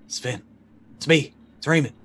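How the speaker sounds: calling out loud and high to wake him; urgent call. Acted. Ray calling out to his unconscious friend, loud and urgent. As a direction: shout his name to rouse him. Player voices Ray waking Sven.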